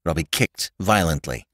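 plain reading voice

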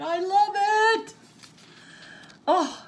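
very high, drawn-out squeal of joy; real overjoyed shriek of delight. Real, not acted. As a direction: squeal it loud and long. Overjoyed at gift, repeats it rising.

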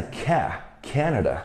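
Canada with the stress on CA; stress on first syllable. Shown as a demonstration. Teacher showing where the stress falls, first-syllable stress on Canada. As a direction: isolate Ca-, then say Canada leaning on that first syllable. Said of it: "重音在Ca".

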